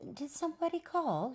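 high lady's voice. A narrator's reading. Lady coming round the corner asking, high voice. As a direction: ask it lightly, pitched high. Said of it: "came a lady pushing a baby carriage".

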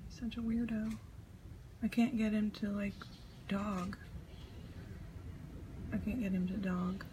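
ordinary talk

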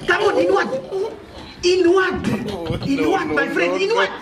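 heated demand. Acted. Man pressing his rival for an answer, high and heated.

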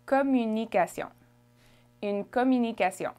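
"Communication" smoothly in French; French pronunciation. Shown as a demonstration. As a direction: model "Communication" with French vowels and nasal ending. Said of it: "How would you say it?".

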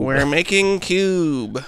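very slow, stretched impression; David Lynch. Answering co-host's intro idea, very slow impression. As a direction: draw the words right out in imitation. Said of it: "listened to David Lynch say".

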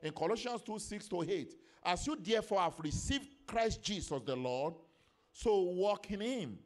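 loud, high, emphatic pulpit delivery; full-force preaching. Real, not acted. Preacher teaching the church about the Holy Ghost, full-force preaching. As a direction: drive each phrase home to the congregation.

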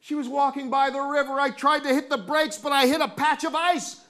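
high, urgent storytelling building to a crash; excited narration, one mishap after another; breathless climax. A narrator's reading. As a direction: tumble through the chase, pitch held high.

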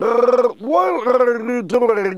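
high, slow, bubbly underwater voice; talking underwater. Pretend. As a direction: speak as if under water. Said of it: "do that with the underwater".

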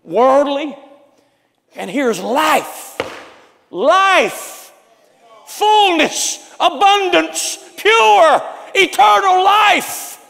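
shouted, high preaching climax; full-throated revival shout; preaching at full force. Acted. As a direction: proclaim it to the back row.

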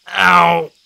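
short cry of pain. A narrator's reading. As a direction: let pain cut in mid-sentence. Pain interrupts his sentence.

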